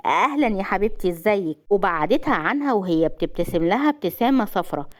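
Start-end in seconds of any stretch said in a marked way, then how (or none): none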